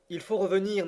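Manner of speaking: plus aigu, lancé avec force sur « faut »; s'écrier « Il faut revenir » en appuyant « faut »; exclamation. Acted. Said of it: "m'écriai-je". (cri d'alarme, « faut » martelé)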